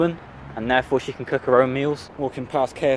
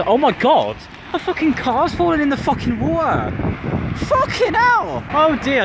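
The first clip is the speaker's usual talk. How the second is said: can't believe what you're watching; real disbelief at a car in the canal; shocked excitement; 11 semitones higher than usual. Real, not acted. Car falling in the water.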